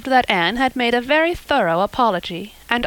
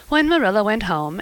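ordinary delivery

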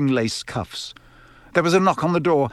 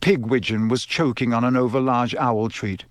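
usual speaking voice

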